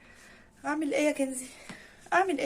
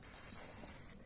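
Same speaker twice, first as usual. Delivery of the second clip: whispered pss calls; 8 dB quieter, slower than usual. Real, not acted. Calling the cat softly.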